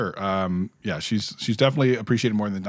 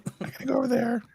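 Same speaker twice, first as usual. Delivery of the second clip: very high voice, brushing him off to leave; mock dismissive fan. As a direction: play a fan who loses interest and hurries away. Mocking fan walking away disappointed.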